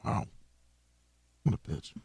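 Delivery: usual speaking voice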